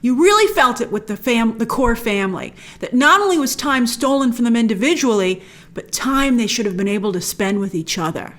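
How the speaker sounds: usual speaking voice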